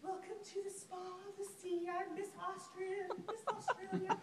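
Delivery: lightly voiced pageant hostess; pageant greeter. Spa girls greeting her.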